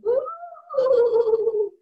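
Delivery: high-pitched ghostly woo; Mama Ghost wail. Retold or imitated. Mama Ghost haunts the house, high wail. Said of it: "Mama Ghost going".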